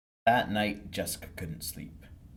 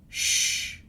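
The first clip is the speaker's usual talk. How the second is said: shush; 6 dB louder than usual. Acted. Teacher hushes the class.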